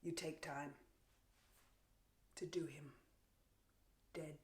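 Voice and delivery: low, near-whispered command; quiet, menacing relish; hushed death order. Acted. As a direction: give the killing order very softly. Orders execution coldly.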